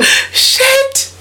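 sudden high yelp of dismay; very high startled shit; real dismay. Real, not acted. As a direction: caught out by her own mistake. Dismay at the [name] mistake.

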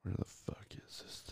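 muttered under breath; whispering. Real, not acted. Searching for a video, muttering.

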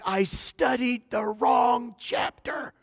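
high, drawn-out tearful wail; mock wail. Crying girl tells her teacher, mock wailing. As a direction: imitate a distraught student, stretching every word out high.

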